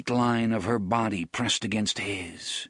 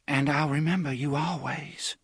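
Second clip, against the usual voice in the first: put-on tender young woman; 5 semitones higher than usual. A narrator's reading. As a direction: promise it tenderly as the girl.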